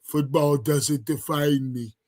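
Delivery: slow, mock-solemn imitation of the player; imitating his delivery. Streamer mocking the player's interview line, slow and mock-solemn. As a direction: drag it out like he's being profound. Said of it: "That's how he sounded".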